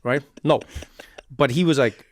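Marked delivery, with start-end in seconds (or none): 0.0-0.6 higher, louder insistent Bernie voice; Bernie Sanders impression. Host hamming up Bernie's habit for the guest. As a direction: hammer the repeated let me like Bernie. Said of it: "He always does this".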